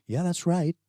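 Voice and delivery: high-pitched San Diego teen impression; San Diego accent. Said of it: "do a San Diego accent".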